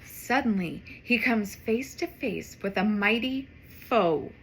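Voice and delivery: plain reading voice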